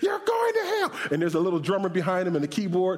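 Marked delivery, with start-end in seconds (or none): 0.0-0.9 very high screamed warning; mock hellfire screech; imitated preacher's scream. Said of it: "He started screaming".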